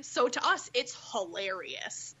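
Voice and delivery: normal talking voice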